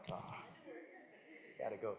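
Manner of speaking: usual speaking voice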